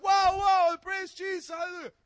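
high, loud joyful wows; ecstatic shouting. Retold or imitated. Healed man dancing, praising Jesus, shouting high and loud. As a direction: burst out with joy.

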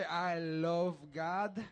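slow, drawn-out mock foreign accent; exaggerated romantic accent, drawling slowly; put-on love accent. Shown as a demonstration. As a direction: stretch every word in a goofy lovey accent. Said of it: "this is how we're gonna say".

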